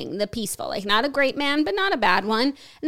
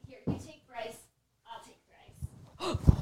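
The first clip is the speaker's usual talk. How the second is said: soft, nearly whispered handoff; hushed; 11 dB quieter, slower than usual. Real, not acted. Parent quietly handing over the toddler, hushed.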